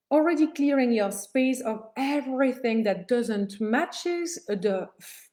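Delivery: ordinary delivery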